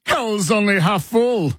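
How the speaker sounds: high, stretched-out playful holler; mock shouted catchphrase. Co-host echoing a shout from one Model T to another, mock holler. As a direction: draw it out high like a call between cars. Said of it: "would have been shouted from one Model T".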